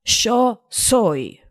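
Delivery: neutral Spanish accent, not Argentine; non-Argentine Spanish accent. Shown as a demonstration. Podcast host demos Spanish for listeners, neutral Spanish accent. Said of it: "in a not Argentine accent".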